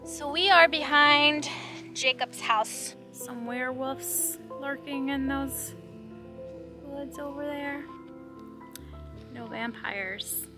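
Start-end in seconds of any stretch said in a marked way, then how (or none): 3.1-10.5 playful hush. Pretend. Playful lookout for lurking werewolves.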